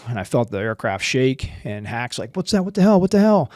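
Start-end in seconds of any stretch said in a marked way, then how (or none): 2.4-3.5 high, loud, urgent imitation of a pilot; acted startled outburst; retold alarm. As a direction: call out as if the aircraft just got hit. Pilot reacts to aircraft shaking.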